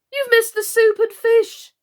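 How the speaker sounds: high, loud imperious queen; Red Queen. A narrator's reading. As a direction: bark it out like a haughty queen. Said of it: "she said".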